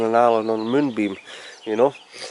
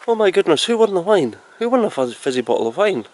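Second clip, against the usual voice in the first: high, startled oh my goodness; suddenly realising, pitched up; surprised; 7 semitones higher than usual. Real, not acted. Realises she forgot who won.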